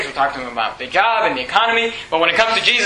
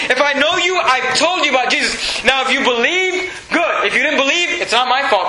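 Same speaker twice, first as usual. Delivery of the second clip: raised, higher pleading as Paul; impassioned preaching; 6 semitones higher than usual. Retold or imitated. Preacher voicing Paul to the congregation, impassioned and higher. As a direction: press it on them like Paul pleading.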